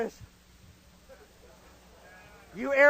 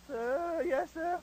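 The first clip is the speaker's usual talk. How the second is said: softer, higher recruit voice; Freeman Spears; 5 semitones higher, 7 dB quieter, much slower than usual. Retold or imitated. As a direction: answer meekly in a small high voice. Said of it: "He talked just like that".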